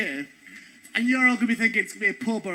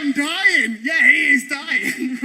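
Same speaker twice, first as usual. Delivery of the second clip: laughing; 7 dB louder, slower than usual. Retold or imitated. Retold joking to actors, laughing.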